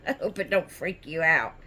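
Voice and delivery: ordinary talk, nothing marked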